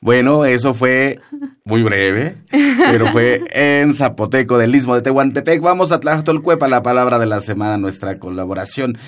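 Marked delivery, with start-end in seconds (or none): 0.0-5.6 louder, higher, laughing through the words; bubbling over with delight at the greeting. Real, not acted. Host thrilled by the Zapotec greeting, laughing and louder. 5.6-7.8 much higher and louder, fast hype intro; upbeat announcer. Real, not acted. Radio host launching the word of the week, excited announcer call.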